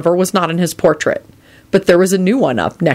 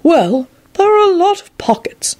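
put-on enthusiastic old wizard; 12 semitones higher, slower than usual. A narrator's reading. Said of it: "he said enthusiastically".

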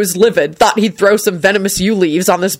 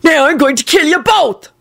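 furious threat; 10 semitones higher than usual. A narrator's reading. Robber furious.

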